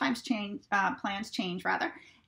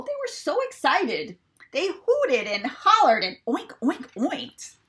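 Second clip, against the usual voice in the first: much higher, clucking excitedly; excited chickens; 5 semitones higher, 5 dB louder, slower than usual. A narrator's reading. Narrator playing excited chickens clapping and clucking. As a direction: cluck it out like happy hens.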